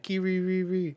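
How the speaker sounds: high, loud squeaky chirp; a high cartoon critter chirp; creature noise. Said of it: "And he's like".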